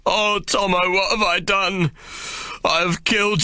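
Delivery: high, grief-stricken and guilty; a friend sobbing over a body. Acted. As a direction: weep the words through big gasps. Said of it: "sobbing in big gasps".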